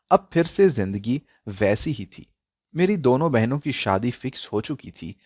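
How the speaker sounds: plain reading voice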